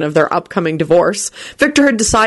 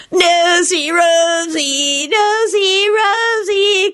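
high singsong, stretched out long; cackling taunt; 12 semitones higher, much slower than usual. A narrator's reading. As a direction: tease in a drawn-out, cackly high voice. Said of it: "cackled Kenny".

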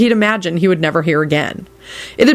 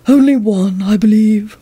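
higher-pitched voice of a grave servant; 4 semitones higher than usual. A narrator's reading. Servant reporting the day's killings to a guest, gravely. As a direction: answer gravely, in character as the doorman. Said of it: "Barnabas responded gravely".

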